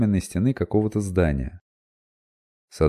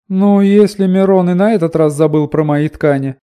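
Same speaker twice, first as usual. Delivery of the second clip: much higher, loud threatening woman; commanding woman; 12 semitones higher, 9 dB louder than usual. A narrator's reading. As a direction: threaten like a bossy trader woman. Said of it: "пригрозил властный женский голос".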